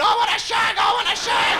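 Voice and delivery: very high, loud, shrieking scream; high loud comic shriek. Acted. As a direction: let the dick scream it out. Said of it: "your dick screaming".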